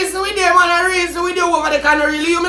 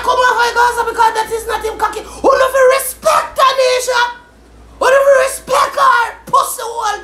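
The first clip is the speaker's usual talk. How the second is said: raised, high angry rant; angry shouting; 5 semitones higher than usual. Real, not acted. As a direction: tell her off at a shout.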